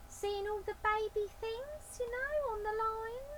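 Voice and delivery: high, loud cockney lady voice; a brassy high-pitched woman burglar; Burglar Betty voice. Acted.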